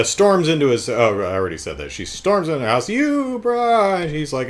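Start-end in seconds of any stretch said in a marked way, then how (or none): 2.9-4.0 high, drawn-out indignant cry; mock outraged cry. Woman storming into his house, mock outraged cry. She storms in to confront him.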